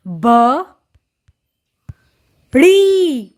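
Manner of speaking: ब, then ड़ी, drawn out with a gap between; syllable by syllable. Shown as a demonstration. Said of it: "जोड़कर पढ़ेंगे".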